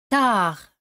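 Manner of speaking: "Tard" with no final d, ending on the r; French tard, silent d. Shown as a demonstration. Teacher models "Tard" with a silent final d. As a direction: say "Tard" without the d. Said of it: "D al final tampoco se pronuncia".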